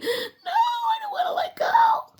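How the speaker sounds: put-on very high, laughing little-kid whine; laughing child whine. Getting off the preschool bus, giggling high toddler whine. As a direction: squeal it through giggles like a clingy toddler. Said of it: "Because I'm like".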